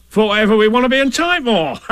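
Tigger's voice. Acted. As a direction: bluster it like a confident braggart. Tigger answers Roo.

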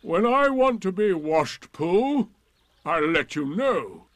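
loud, high indignation; scornful donkey voice; sarcastic Eeyore. Acted. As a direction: rebuff them with dignity.

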